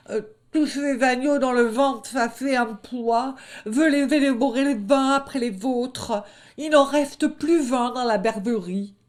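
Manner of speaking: high, loud pained boasting; high hurt wolf bluffing; sore bragging wolf. Acted. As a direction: lie through the pain. Hurt wolf lies about eating.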